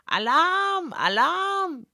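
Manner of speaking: very high, frantic shrieking alarm; a shrill little imp panicking; hysterical Fräulein Zwang. Acted. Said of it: "brüllte sie mit solch einem hohen, keifenden, ja hysterischen Ton". (Fräulein Zwang kreischt hysterisch und sehr hoch)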